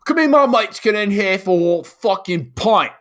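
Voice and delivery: put-on loud, high drunk British lad voice; drunk British kid. Imitating a kid asking the bouncer for entry, drunk British. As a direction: holler it in a boozy British accent. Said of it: "drunk, just like".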